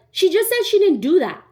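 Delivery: high, loud imitation of a boyfriend snapping at her; aggressive boyfriend. Girlfriend's boyfriend turning around on the narrator: high loud mock snap. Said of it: "already coming at me crazy".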